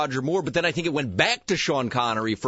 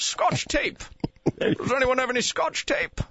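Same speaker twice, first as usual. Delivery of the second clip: higher, hammed-up Scottish Connery voice; mock Scottish accent; 9 semitones higher than usual. Host joking on air, hammed-up Scottish accent. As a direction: ham up a Scottish brogue on Scotch. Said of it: "words that sound like Scottish".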